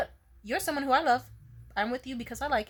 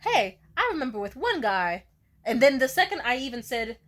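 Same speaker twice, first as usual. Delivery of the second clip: ordinary talk, nothing marked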